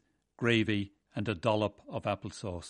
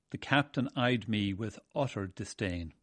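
usual speaking voice

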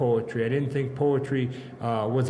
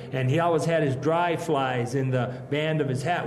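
ordinary talk, nothing marked

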